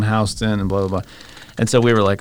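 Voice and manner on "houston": "Houston" said as HOW-stən; mispronounced street name. Retold or imitated. Lady giving lost teenagers directions, "Houston" as HOW-stən. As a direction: say "Houston" with a first syllable like "house". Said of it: "doesn't know how to pronounce Houston".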